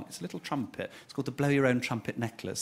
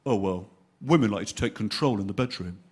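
laddish advice; 6 semitones lower than usual. Retold or imitated. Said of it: "he immediately went".

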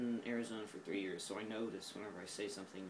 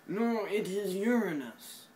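loud, much higher, slow "Uranus" as YOOR-nus; insistent correction; 9 semitones higher, 10 dB louder, slower than usual. Acted. Mock-correcting herself on camera about saying Uranus, loud and slow. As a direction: belt it out like you're correcting a fool. Arguing over how to say Uranus.

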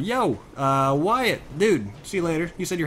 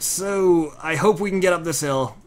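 ordinary talk

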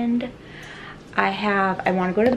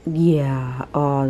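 ordinary talk, nothing marked

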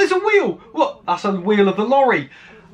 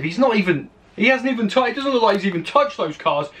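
ordinary delivery

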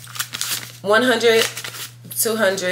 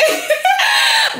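high squealing laugh; shrieking laugh. Real, not acted. Loud burst of laughter.

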